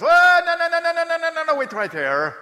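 put-on very high, loud Bermudian-accent "no, no, no"; imitating Burton from Bermuda in an excited high squeal. Burton waves off the kid in a very high, loud Bermudian accent. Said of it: "Is that a good accent".

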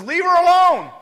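loud, high rebuke: leave her alone; sharp loud command of Jesus; commanding shout. Acted. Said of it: "and Jesus says".

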